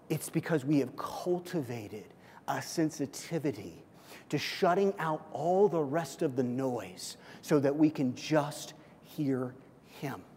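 normal talking voice

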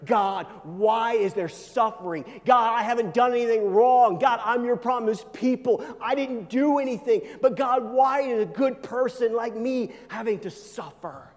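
high, loud cry to God; anguished plea. Acted. Sufferer questions God over undeserved suffering, anguished plea. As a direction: plead upward with mounting force.

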